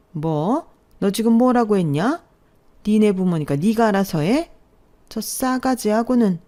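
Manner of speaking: mildly heightened, incredulous scolding; affronted mother-in-law. Acted. Mother-in-law answering her insolent daughter-in-law, affronted scolding.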